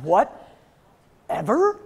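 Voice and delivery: slow, drawn-out, rising disbelief; incredulous echo. Retold or imitated. Mom answering her teen's backtalk, incredulous. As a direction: throw the word back in outrage, very slowly. Mom echoes daughter's word, affronted.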